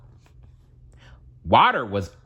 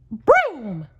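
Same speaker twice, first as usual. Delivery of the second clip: high cartoon mouse voice giving an order; Mickey commanding. Mickey ordering the broom in his high mouse voice. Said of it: "commanded Mickey".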